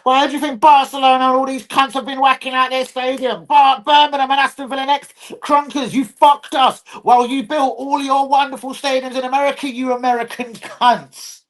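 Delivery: high, heated tirade at the owners; heated rant, stretching the final insult; angry rant. Real, not acted. Ranting at club owners.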